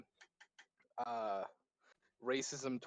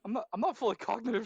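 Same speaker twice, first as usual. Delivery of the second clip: usual speaking voice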